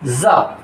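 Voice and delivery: जा said as a buzzed "za", low; isolated low जा pronounced "zaa"; dotted Za sound. Shown as a demonstration. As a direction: say जा with a Z, not a J. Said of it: "जिसको हम जा बोलते हैं".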